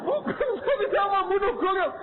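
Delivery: high, sobbing cry of innocence; crying denial. Retold or imitated. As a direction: wail that you never killed anyone. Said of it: "nangis, nyembah-nyembah". (mahasiswa menangis keras menyangkal membunuh)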